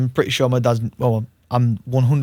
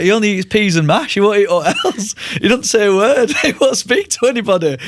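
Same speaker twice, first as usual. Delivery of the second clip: high, louder mock mum voice, broken by laughs; hammed-up high-pitched protesting mum; imitated exasperated mum; 9 semitones higher, 4 dB louder than usual. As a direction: play mum's complaint up high, amused. Imitates mum's complaints to doctors.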